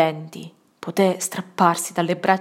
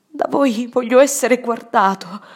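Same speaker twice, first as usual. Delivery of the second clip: insistent pleading; 5 semitones higher, 4 dB louder than usual. Acted. Pleading at deathbed.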